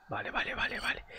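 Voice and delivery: whispered under his breath; barely audible whisper. Real, not acted. Whispering to himself mid-fight.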